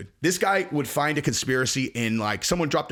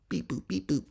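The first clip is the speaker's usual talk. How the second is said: soft mock phone beeps; 5 semitones lower, 8 dB quieter than usual. Imitating phone buttons.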